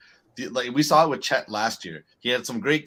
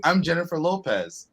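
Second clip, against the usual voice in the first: higher-pitched star stating her full name; imitated famous woman, pitched up; 6 semitones higher than usual. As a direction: lightly act out a celebrity expecting recognition. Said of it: "[name] going to her".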